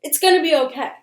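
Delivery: a hint of unsettling calm turning pushy; eerie insistent reassurance. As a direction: repeat it with creepy insistence. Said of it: "keeps saying".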